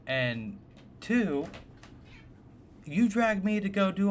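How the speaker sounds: put-on a thirteen-year-old shouting that it's unfair; outraged teen protest. Teen to dad, left out of the trip: high, loud outraged protest. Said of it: "I was like".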